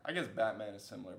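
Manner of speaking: normal talking voice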